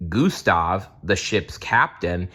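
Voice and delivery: ordinary delivery, nothing marked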